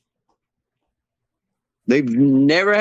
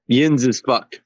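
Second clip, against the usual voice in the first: mock Pittsburgh; 8 semitones lower, slower than usual. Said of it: "do the accent of every team".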